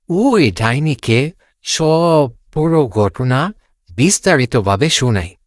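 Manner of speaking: ordinary delivery, nothing marked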